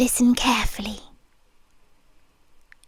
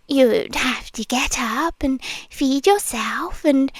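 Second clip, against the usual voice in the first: normal talking voice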